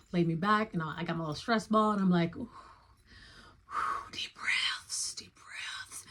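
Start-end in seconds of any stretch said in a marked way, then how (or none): 2.4-6.0 soft unvoiced whisper, breathing through nerves; whispered self-calming. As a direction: hush yourself through the fear with deep breaths. Calming herself on the table.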